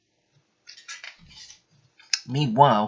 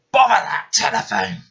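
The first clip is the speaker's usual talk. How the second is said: cross and louder; frowning; 10 semitones higher, 4 dB louder than usual. Acted. Fat Controller grumbles at the phone, a touch cross and louder.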